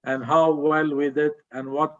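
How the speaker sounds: ordinary talk, nothing marked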